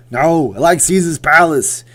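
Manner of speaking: slow, loud Austrian action-star impression; stubborn refusal in a hammy Austrian accent; high, bellowed action-hero voice; Arnold Schwarzenegger. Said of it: "Mike versus Arnold was funny".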